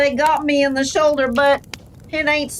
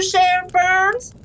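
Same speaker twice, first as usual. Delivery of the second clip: ordinary talk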